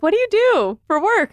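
imitating a curious asker. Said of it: "when people are like".

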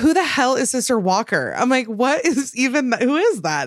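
high-pitched mock bafflement; incredulous. Podcast host mocking a mission letter's wording, incredulous. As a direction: act out being totally thrown by the name.

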